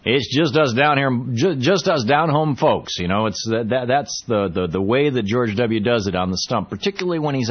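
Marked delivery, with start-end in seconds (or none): 0.0-3.0 a light, hammed-up Texas drawl; mock Texas accent, drawn out; heavy Texas accent. Shown as a demonstration. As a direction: lean into the down-home Texas twang. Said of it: "Heavily accented".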